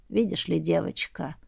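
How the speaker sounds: put-on quiet, low elderly voice; low old man voice. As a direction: speak like a wise old man. Old shaman addresses her.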